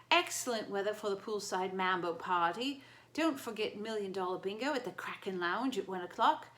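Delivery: loud chirpy Australian announcer; a too-happy Australian on the intercom; cheery Australian accent. Acted. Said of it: "Australian accent that sounded way too happy".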